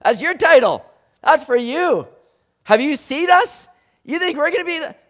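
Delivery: high, loud protest of disbelief; incredulous disciples. Retold or imitated. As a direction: balk at the title. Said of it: "disciples were sitting there going".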